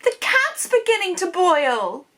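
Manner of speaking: high, loud, drawn-out shriek; hammed-up child's shriek from a story. A narrator's reading. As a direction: wail it slowly as a horrified little girl. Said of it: "she shrieked".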